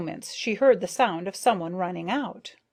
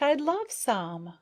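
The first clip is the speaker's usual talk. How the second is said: eager; 6 semitones higher, slower than usual. A narrator's reading. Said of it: "Maya burst out".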